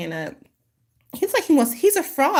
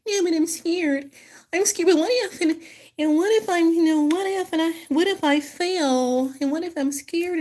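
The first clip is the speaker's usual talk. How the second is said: much higher, small, timid whimper; wimpy scared voice; 9 semitones higher than usual. Retold or imitated. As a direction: play the frightened one afraid to fail. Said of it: "I get, like, this wimpy type of".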